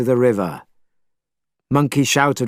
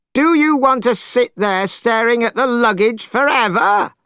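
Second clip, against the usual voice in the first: angry shout; 11 semitones higher than usual. A narrator's reading. Monkey yelling at the crying priest, exasperated. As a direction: spit out the word hopeless twice. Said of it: "still shouting angrily".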